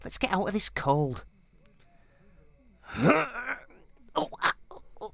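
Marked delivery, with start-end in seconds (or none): 2.9-3.6 startled gasp. Acted. Startled by commander.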